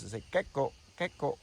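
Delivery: loud gecko chirp on the name; gecko call. Retold or imitated. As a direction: chirp gecko like the lizard does. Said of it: "That's why they're called geckos".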